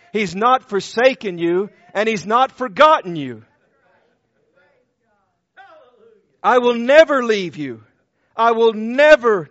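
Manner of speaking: loud, rising pulpit preaching building to a peak; fervent preaching. Acted. Preacher proclaiming Jesus' mission to the congregation, fervent and loud. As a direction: preach it out to the congregation at full force.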